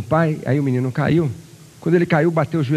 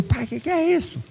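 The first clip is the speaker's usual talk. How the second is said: put-on boy's amazed question; 3 dB quieter than usual. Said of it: "O menino correu e falou".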